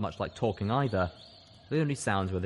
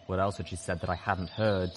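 plain reading voice

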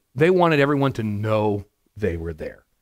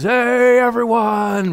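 high, loud drawn-out greeting; calling out; 11 semitones higher, 6 dB louder, much slower than usual. Acted. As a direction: hail the whole marketplace for attention. Pharisee greets whole marketplace loudly.

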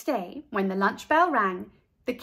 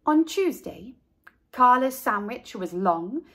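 plain reading voice

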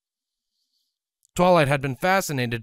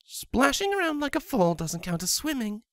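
much higher, teasing mare voice; jabbing; 13 semitones higher than usual. A narrator's reading. Scarlet mocking her husband's swimming, teasing jab. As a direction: needle him with a grin. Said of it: "Scarlet jabbed".

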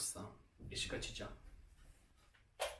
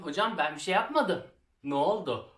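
higher, louder protest to the teacher; a pupil pleading with an angry teacher, louder; 3 semitones higher, 7 dB louder than usual. Retold or imitated. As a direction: plead your innocence to the teacher.

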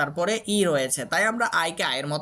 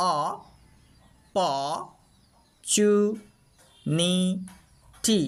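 "অ, প, চু, নি, টি" each alone with long pauses; syllable by syllable; slower than usual. Shown as a demonstration. Teacher has learners listen again, syllable by syllable. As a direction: break it into অ, প, চু, নি, টি. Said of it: "আবারও একবার শোনো".

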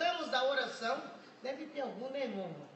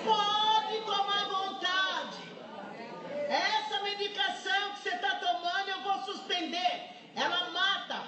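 full-force preaching; 6 semitones higher than usual. Acted. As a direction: proclaim it over the church, slowly. Preacher's climax on hate.

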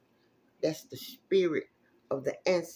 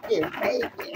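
excited squeal. Real, not acted. Reacting to a card pulled.

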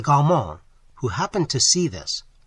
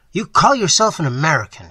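sneering; 4 semitones higher, 9 dB louder than usual. A narrator's reading. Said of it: "he sneered".

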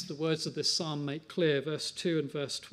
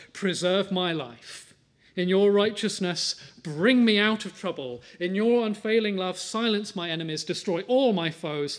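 fervent scripture prayer; 3 semitones higher, 9 dB louder than usual. Acted. Reads the psalm prayer.

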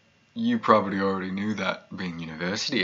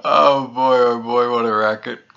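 high, loud, laughing exclamation; gleeful laughing; 3 semitones higher, 9 dB louder than usual. Acted. Pyromaniac relives the cats' racket in the yard, gleeful laughing. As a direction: crow with laughter at the memory. Gleefully recalls the burning cats.

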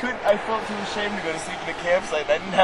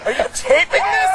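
alarmed shout; 12 semitones higher, 9 dB louder than usual. Real, not acted. Storyteller realizing he's being taped, alarmed shout. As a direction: shout it high and loud, suddenly alarmed.

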